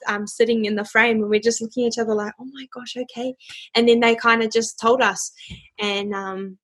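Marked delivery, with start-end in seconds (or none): 2.4-3.3 very quiet, under-the-breath aside; hushed disbelief. Retold or imitated. Daughter to mum while on the Skype call, hushed disbelief. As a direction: whisper it privately, as if still on camera.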